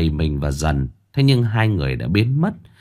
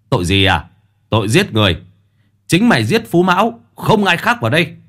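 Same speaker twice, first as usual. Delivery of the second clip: quát buộc tội; 7 semitones higher, faster than usual. Acted. Quan quát buộc tội Dần.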